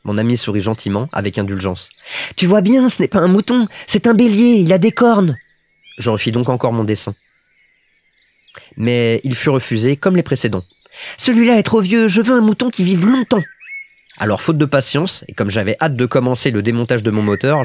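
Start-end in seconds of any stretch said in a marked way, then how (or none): 2.4-5.4 put-on high little-boy voice, louder and emphatic; correcting Petit Prince. A narrator's reading. Little prince corrects the pilot's drawing, high and insistent. 11.2-13.4 put-on high, emphatic little-boy voice; protesting Petit Prince. A narrator's reading. Little prince rejects the pilot's boa drawing, high and insistent.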